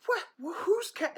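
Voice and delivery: high, baffled yelp; confused. Retold or imitated. Man confused by a stranger's cat, high baffled yelp. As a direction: sputter in disbelief.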